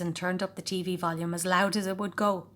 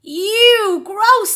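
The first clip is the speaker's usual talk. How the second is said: loud, high screaming audience; a loud, high crowd scream; 14 semitones higher, 13 dB louder than usual. A narrator's reading. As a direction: yell it like a rowdy TV crowd. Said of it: "screamed the T V audience".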